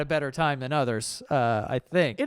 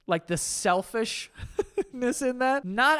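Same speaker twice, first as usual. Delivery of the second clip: laughing; 7 semitones higher, slower than usual. Real, not acted. Laughing at selfish gift.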